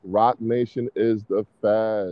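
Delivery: slow, drawn-out insistence; slow, emphatic. Real, not acted. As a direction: spell it out slowly to drive the point home. Repeats claim slowly for emphasis.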